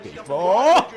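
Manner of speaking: mock quote. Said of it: "got me on the penis before".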